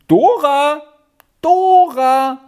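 high, loud, drawn-out call; calling from above. A narrator's reading. Aunt upstairs summons her niece Dora, calling loudly. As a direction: shout the name down to someone below. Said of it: "Da rief es von oben".

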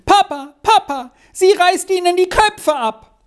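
put-on very high, squealing child's cry. A narrator's reading. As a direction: call out to Papa in alarm, pitched right up. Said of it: "schrie ihm aus allen Kräften zu". (aus allen Kräften schreiend)

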